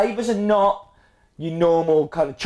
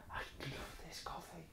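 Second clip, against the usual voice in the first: whispering; 28 dB quieter than usual. Retold or imitated. Recalling first cupping reaction.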